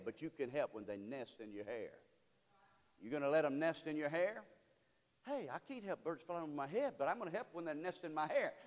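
normal talking voice

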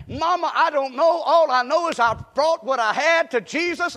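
put-on high, loud, eager boy; earnest little boy. Little boy explaining to mama, eager and loud.

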